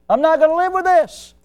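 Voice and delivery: determined resolve. Retold or imitated. Church member deciding to mend things, high resolute declaration.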